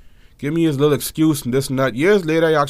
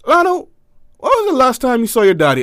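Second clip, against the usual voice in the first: higher, sugary greeting; suspiciously sweet sibling hello; sister's sweet voice; 10 semitones higher, 3 dB louder than usual. Retold or imitated.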